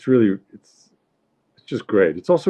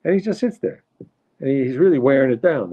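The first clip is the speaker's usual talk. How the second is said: higher-pitched New York wife impression; complaining mother; 7 semitones higher than usual. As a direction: air a grievance about the husband. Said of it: "She says".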